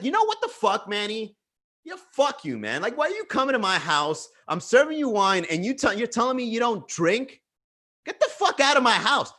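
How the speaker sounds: mock her fury, throwing him out; retold indignant tirade; imitating her angry rant. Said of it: "of, like, anger".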